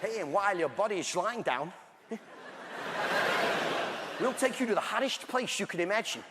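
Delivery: put-on comic Dutch accent, slurred sibilants; hammed-up Dutch accent. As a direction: play a laid-back Dutch host. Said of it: "Hell in a Dutch accent".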